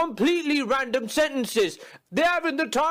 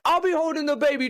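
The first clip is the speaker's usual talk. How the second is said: ordinary talk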